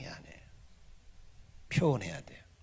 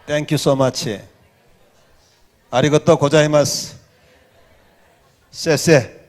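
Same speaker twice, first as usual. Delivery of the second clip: foreign thank-yous; 9 dB louder, much slower than usual. Real, not acted. Says thanks in foreign languages.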